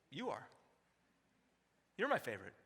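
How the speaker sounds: put-on soft, tender answer to a child; tender reassurance. Father soothing his kid who asks who's favorite, soft. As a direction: hush it to your child like a secret. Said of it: "I'd always say".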